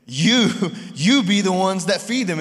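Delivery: "You" pressed, then repeated with a laugh; emphatic you. Retold or imitated. Jesus telling the disciples they must feed them, "You" stressed. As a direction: hit "You" hard in "You be the ones that feed them". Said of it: "was, was emphatic".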